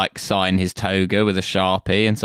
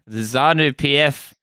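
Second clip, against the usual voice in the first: mock Zimbabwean accent; 6 semitones higher, 4 dB louder than usual.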